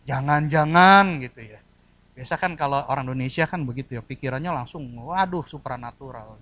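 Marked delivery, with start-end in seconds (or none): 0.1-1.6 suspicious. Acted. Acts suspicious superstitious thought.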